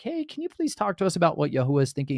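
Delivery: lightly hammed-up eager asking; eager leaders. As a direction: rattle off the request like eager petitioners.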